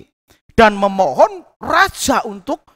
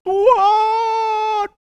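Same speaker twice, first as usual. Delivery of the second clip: a crying cry of Tuhan, higher; acted crying; 10 semitones higher than usual. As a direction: wail Tuhan like a weeping man. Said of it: "Wah, nangis begitu".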